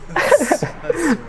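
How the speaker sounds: a real high-pitched burst of laughter; giggling at the memory. Real, not acted. Laughing at own story.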